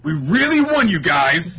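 put-on overjoy the repeat like a giddy kid; hammed-up gloat; mock shrill victory squeal. Repeat pushed far higher.

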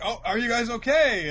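imitated anxious shout; acted concern. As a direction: call down to friends, mock worried. Acted calling down to the fallen.

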